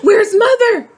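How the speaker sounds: high, loud frightened call; mother yelling for grandma at the fire; yelled question. A narrator's reading. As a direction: shout it out, searching for her mother. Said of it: "Mama yelled".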